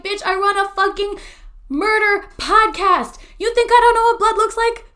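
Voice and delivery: high, loud mock outrage; indignant retort. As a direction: belt it out as an offended comeback. Retold indignant retort to cleaning lady.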